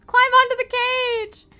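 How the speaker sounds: high, loud, slow call; shouting. Acted. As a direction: yell across the water. Calling out to Wendy.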